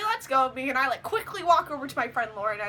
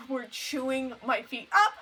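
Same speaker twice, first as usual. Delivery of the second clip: ordinary talk, nothing marked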